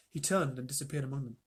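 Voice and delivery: plain reading voice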